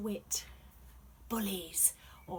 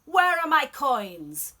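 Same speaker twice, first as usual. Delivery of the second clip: angry emperor; 4 semitones higher, 11 dB louder than usual. Acted.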